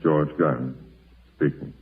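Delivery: ordinary delivery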